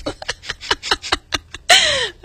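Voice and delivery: a burst of high, helpless giggling; can't stop laughing; high giggle. Real, not acted. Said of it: "I don't know why I'm laughing so much".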